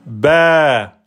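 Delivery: "Ba" held over two beats; long vowel. Shown as a demonstration. Teacher demonstrating two beats, long "Ba". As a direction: hold the vowel of "Ba", falling at the end. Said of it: "pour dire que c'est deux temps".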